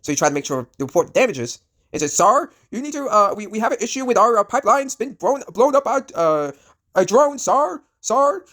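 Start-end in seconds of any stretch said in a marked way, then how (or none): 2.1-8.4 mock Indian accent. Oil depot worker reports drone strike to his boss, high mock Indian accent. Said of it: "you can hear his accent".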